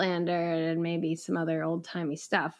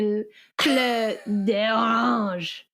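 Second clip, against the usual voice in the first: put-on French accent. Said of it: "do it in French".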